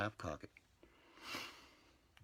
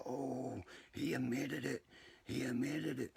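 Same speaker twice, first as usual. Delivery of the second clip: higher, taunting sing-song; mocking gossip voice; 8 semitones higher, slower than usual. Mocking viewers who will twist his video, higher taunt. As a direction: mimic the gossiping viewers, pitched up. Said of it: "flip it like".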